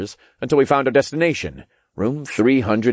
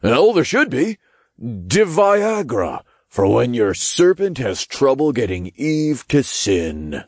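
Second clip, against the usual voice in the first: character voice; 5 semitones higher than usual. Acted. Crude joke from a character.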